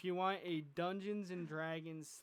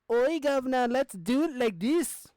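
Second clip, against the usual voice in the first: higher, loud cockney-flavoured mashup voice; mixed cockney voice; 6 semitones higher, 11 dB louder than usual. Shown as a demonstration. All the voices blended into one, loud mock cockney. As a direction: shout it like a muddled Cockney character. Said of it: "I'm going to have something like".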